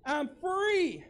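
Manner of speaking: rising joyful declaration; joyful I'm free. Shown as a demonstration. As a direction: proclaim freedom with a lift.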